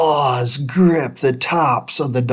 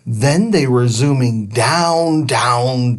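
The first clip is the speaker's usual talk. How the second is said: usual speaking voice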